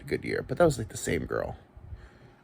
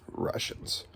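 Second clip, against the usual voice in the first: whisper; 7 dB quieter than usual. Pretend. Whispered ominous movie villain name.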